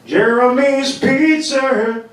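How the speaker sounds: [name] impression. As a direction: croon it like a grunge singer at Pizza Hut. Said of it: "this is my impression of [name]".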